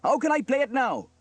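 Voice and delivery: high, loud reproach; upset cry. A narrator's reading. Trevor scolds Elvis for the drum, crying out. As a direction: cry out over the damage. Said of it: "cried Trevor".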